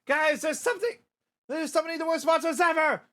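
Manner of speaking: high, urgent alarm; high-pitched cartoon panic; panicked warning. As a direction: raise the alarm to the team. Character warns of approaching monsters.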